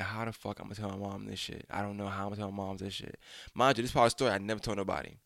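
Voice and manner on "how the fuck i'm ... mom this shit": dazed, much softer inner worry; dazed muttering. Retold or imitated. Dazed boy dreading telling his mom, muttered softly. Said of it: "I'm dazed out right now".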